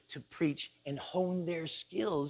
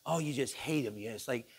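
usual speaking voice

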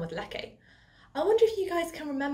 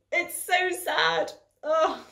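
mock sad; 7 semitones higher, 4 dB louder, much slower than usual. Moaning about eating only broccoli.

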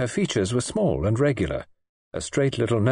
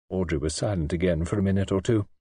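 plain reading voice

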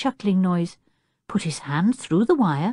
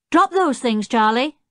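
high, urging command; giving orders; 7 semitones higher, 3 dB louder than usual. A narrator's reading. Jeremy tells the chimpanzee to unload, commanding. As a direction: order a helper about.

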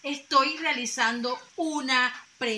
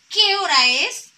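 high, loud question with rising tune; asking a question; 6 semitones higher, 12 dB louder than usual. Shown as a demonstration. Teacher demonstrates a question to the class, high and loud. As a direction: lift the end like a real question. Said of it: "yo estoy realizando una pregunta".

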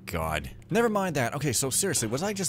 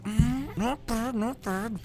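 high appraising voice; 11 semitones higher, slower than usual. Pretend. Pitched-up appraising voice.

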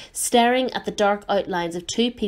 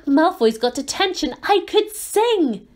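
gleeful; 9 semitones higher, 3 dB louder than usual. Acted. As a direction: squeal it with glee. Hermione jigging with glee.

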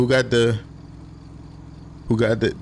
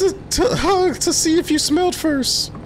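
high friend voice. Acted. Streamer voicing friend character.

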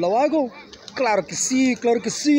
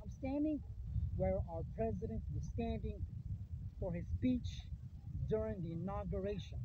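hushed awe; 19 dB quieter than usual. Real, not acted. Awed at president's spot.

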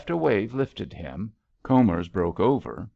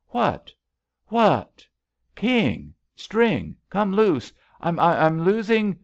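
high, loud, broken exclamations; frantic cries; 10 semitones higher, 6 dB louder, slower than usual. A narrator's reading. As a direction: cry out in bursts while struggling in water. Frantic shouting between drowning swimmers.

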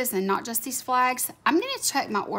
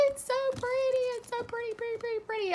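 high cutesy cooing, softer; 12 semitones higher, 4 dB quieter, faster than usual. Real, not acted. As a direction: coo at it like a kitten. Cooing over pretty sticker.

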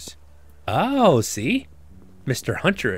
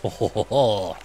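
French chef. Pretend. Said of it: "I'm back talking like the French chef".

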